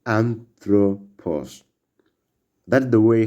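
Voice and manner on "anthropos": anthropos slowly, AN-thro-pos with final s; slow Greek pronunciation. Shown as a demonstration. Teacher summing up Greek word for learners, slow. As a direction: model anthropos plainly and slowly. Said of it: "in the word anthropology".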